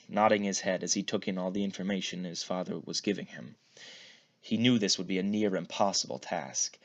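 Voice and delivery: usual speaking voice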